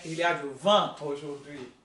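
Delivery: French teacher modelling vent; French model sentence. Shown as a demonstration. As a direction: vent with a French nasal vowel. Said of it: "o francês vai fazer vent".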